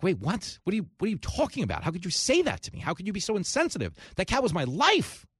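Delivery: hammed-up shock at losing his beloved pet; outraged cat owner. Cat owner hearing his cat died, hammed-up outraged disbelief. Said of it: "He's like".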